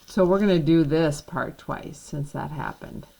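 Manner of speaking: ordinary talk, nothing marked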